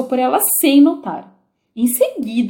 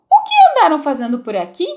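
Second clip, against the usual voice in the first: queen questioning; 6 semitones higher, 5 dB louder, faster than usual. A narrator's reading.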